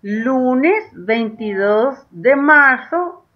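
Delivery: lunes veintidós de marzo said very slowly, word by word; teacher dictating the date; slow dictation. Shown as a demonstration. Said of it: "vas a escribir".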